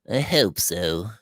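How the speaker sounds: low, slow and grave. A narrator's reading. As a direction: answer heavily, holding back sorrow. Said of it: "she said gravely".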